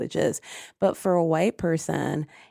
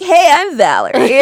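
higher and louder put-on bright greeting; 8 semitones higher, 11 dB louder than usual. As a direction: greet the client in your phone voice. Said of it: "I'd be like".

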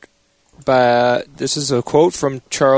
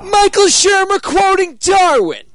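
loud, high, slow announcer yell; announcer shout; 4 dB louder, slower than usual. Pretend. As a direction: boom it out like a game show host. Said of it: "there's an alternate ending to this quote".

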